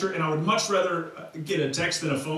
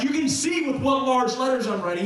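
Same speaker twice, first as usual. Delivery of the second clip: emphatic, higher-pitched proclamation; all caps; 5 semitones higher than usual. Acted. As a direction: say it as if written in capital letters. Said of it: "he was all capsing".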